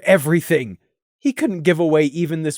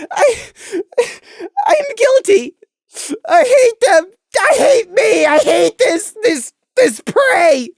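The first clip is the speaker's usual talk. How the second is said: high, sobbing, guilt-ridden breakdown; broken young pony sobbing his confession; high crying pony; 6 dB louder than usual. Acted. Prey confessing his guilt to Crimson, sobbing high.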